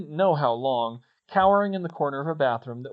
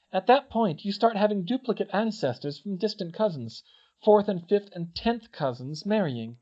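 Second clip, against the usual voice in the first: higher-pitched, emphatic lecturer; Hermione explaining; 7 semitones higher, 3 dB louder than usual. Acted. Hermione trying to convince Harry, earnest lecture. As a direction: press the argument point by point.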